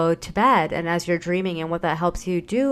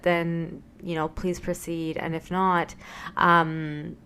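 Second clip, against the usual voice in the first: usual speaking voice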